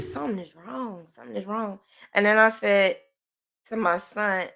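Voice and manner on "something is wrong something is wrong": worried quiet quote. Retold or imitated. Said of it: "So I said".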